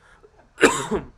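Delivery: loud choking cough; coughing up water. Real, not acted. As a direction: cough it out hard. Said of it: "it's the worst one to choke on".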